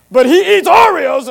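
high, loud, scandalised accusation; mock outrage. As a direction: blow a tiny fault up into a scandal. Mocking outrage over a trivial fault.

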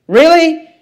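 high disbelieving really; mock astonishment, pitched high; incredulous. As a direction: act stunned he doesn't know. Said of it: "you gotta ask yourself this question".

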